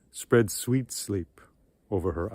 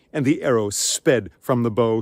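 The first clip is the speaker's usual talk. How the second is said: plain reading voice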